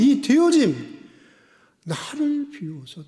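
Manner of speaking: high, loud pulpit proclamation; impassioned preaching. Acted. Preacher calling for a changed way of being, impassioned preaching. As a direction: declaim each phrase to the whole congregation.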